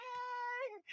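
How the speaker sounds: tiny, high, drawn-out flirty greeting; sugary nice. Acted. Woman greeting the cleaner she now favors, sugary. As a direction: coo it very softly and high. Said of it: "I was nice to him".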